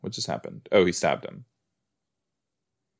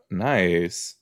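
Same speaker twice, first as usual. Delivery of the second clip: ordinary talk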